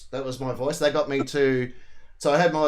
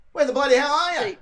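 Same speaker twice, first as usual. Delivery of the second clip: higher, punchy mock tourism-ad line; mock Aussie ad slogan, pitched up; ad catchphrase; 12 semitones higher, 6 dB louder than usual. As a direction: belt it like the Australian tourism slogan. Quoting her famous tourism ad.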